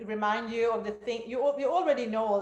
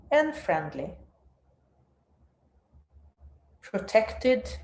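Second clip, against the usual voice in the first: guided meditation; 4 dB louder than usual. Acted. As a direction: guide them down into stillness.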